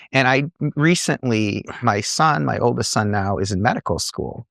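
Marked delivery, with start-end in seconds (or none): none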